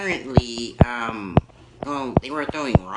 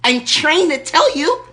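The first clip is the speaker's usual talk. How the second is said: much higher, loud shrill-free lady rant; Erkenbacher; 8 semitones higher, 5 dB louder than usual. Acted. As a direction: scold them as the offended lady caller. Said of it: "Erkenbacher calling back".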